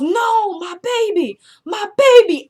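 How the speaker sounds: very high, rising wail of grief; mother wailing over her baby, high and loud; anguished wail. Retold or imitated. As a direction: cry out for the lost baby.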